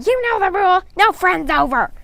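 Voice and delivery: high, shrill-loud screaming sister; bossy older sister screaming a house rule. A narrator's reading. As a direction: scream it down the stairs. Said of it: "Tina screaming".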